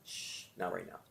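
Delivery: soft, gentle hush to a passing thought; gentle shush. Shown as a demonstration. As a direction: shush your own thought kindly and softly. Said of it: "just gently say".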